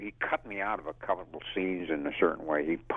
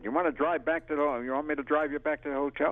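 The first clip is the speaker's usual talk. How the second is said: higher, quick friendly offer; innocently offering a ride, lightly voiced; a hint of Buford's casual invitation; quoting Buford; 7 semitones higher, faster than usual. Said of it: "and he said".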